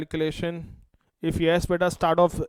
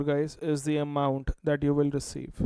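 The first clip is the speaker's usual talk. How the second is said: normal talking voice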